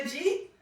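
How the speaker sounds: "Jeet", did you eat run into one syllable; Queens slurred "Jeet". Retold or imitated. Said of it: "the way we say it at home in Queens".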